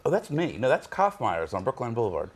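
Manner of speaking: normal talking voice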